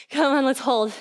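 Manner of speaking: ordinary talk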